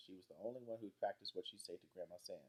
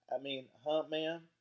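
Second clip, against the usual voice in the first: surprised; 7 semitones higher, 7 dB louder, slower than usual. A narrator's reading. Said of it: "looked surprised and said".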